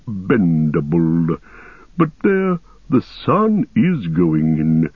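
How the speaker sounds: ancient, unhurried ent voice; Treebeard voice. Acted. Treebeard speaking.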